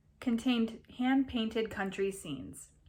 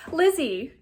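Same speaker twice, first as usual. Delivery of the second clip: high, loud eager cry; excited cry; 6 semitones higher, 8 dB louder than usual. A narrator's reading. Girl bursting into the room, excited cry. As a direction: burst in crying her name. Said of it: "cried the first".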